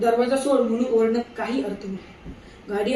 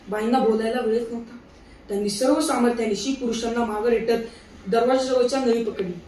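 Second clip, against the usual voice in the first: ordinary delivery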